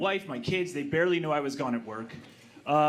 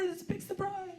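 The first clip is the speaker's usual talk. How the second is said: guests announcing; 7 dB quieter than usual. Retold or imitated. Voicing guests at door.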